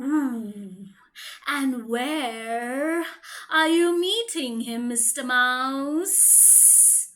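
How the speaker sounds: low slithery snake voice, slow at the end; sly snake. Acted. Snake asks the mouse where he meets the Gruffalo. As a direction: ask low and sneaky like a snake.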